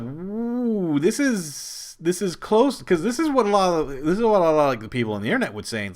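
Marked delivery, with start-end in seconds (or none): 0.0-2.8 high, excited gasp of interest; a thrilled fan leaning in; excited ooh. Retold or imitated. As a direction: get hooked by the twist, voice up high. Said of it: "And I was like".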